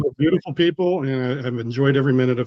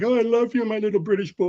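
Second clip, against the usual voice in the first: higher-pitched mock schoolgirl gushing; imitating adoring girls; 7 semitones higher than usual. Guest mimics girls fawning over his accent, mock adoring. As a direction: coo like a smitten schoolgirl, playfully exaggerated. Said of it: "they were all like".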